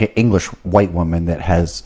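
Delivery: ordinary talk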